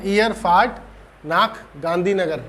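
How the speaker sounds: ordinary delivery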